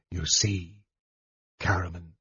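low, quiet, icy remark; cold. A narrator's reading. Mage to his brother as the last foe vanishes, coldly. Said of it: "he said coldly".

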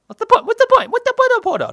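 loud, high hammed-up Italian accent; mock Italian accent. Shown as a demonstration. A comic Italian accent mocking cricket, loud and high. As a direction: belt it like an exaggerated Italian. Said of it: "That's my Italian".

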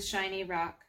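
ordinary delivery, nothing marked

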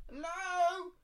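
high, drawn-out call. Acted. Eliza calls again to no one, high-pitched call. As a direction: call out high and thin for anyone there. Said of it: "she called".

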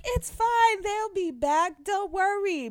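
high, light comforting voice; reassuring idol. Retold or imitated. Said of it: "They were like".

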